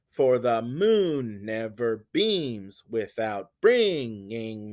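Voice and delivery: very slow, long stresses on "moon", "beams", "dreams"; exaggerated anapestic beat. Shown as a demonstration. Teacher spelling out the stress pattern, exaggerated anapestic beat. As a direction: drag out "moon" and BEAU in "beautiful", light syllables between. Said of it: "two unstressed syllables followed by a stressed one".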